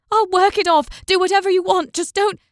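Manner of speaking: very high, loud panicked pleading; frantic begging. A narrator's reading. Mother begging the fisherman with the knife, panicked. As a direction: plead for her hand, cut off. Woman begs, knife raised.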